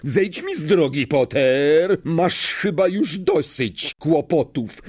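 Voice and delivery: high, forceful snarl. A narrator's reading. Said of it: "warknął Snape". (gniewne warknięcie, wyższym głosem)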